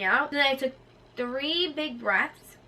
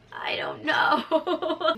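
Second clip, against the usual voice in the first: high, very drawn-out mock cry; groggy wail; 6 semitones higher, 5 dB louder, much slower than usual. Groggy patient answering nurse, mock wail. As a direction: wail it slowly, hammed-up.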